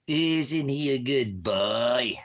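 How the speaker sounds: very slow, high and loud cooing; doting coo. Real, not acted. Dad cooing at his dog, very slow and high. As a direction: stretch it out lovingly to the dog.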